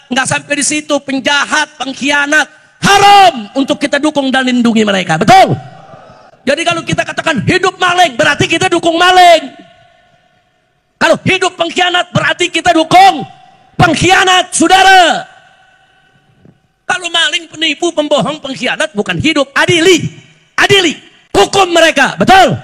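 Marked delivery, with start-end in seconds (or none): 2.8-3.5 "haram" hit loud and drawn out; emphatic verdict. Real, not acted. Preacher forbidding the crowd to back criminals, forceful "haram". 6.5-19.5 raised, forceful rally oratory; impassioned preaching. Real, not acted. Preacher addressing the President before a crowd, impassioned and forceful.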